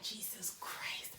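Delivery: slow, soft whispered awe. Retold or imitated. Retelling her stunned thought about the boy, slow whisper. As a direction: breathe it out, overwhelmed. Stunned before first kiss.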